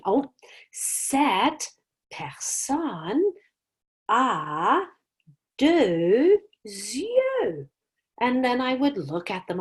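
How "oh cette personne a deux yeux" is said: slow, drawn-out mystery-game voice; dramatic clue reveal. Shown as a demonstration. Teacher teasing her class with a mystery clue, slow and drawn out.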